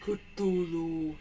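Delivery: low eerie monster name; spooky monster voice. Pretend. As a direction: intone it like an ancient horror. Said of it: "No, I'm kidding".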